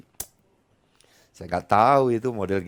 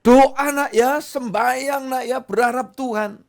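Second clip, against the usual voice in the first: encouraging parent; 11 semitones higher, 5 dB louder than usual. Acted. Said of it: "Ayo ngomong yang enak, support dia".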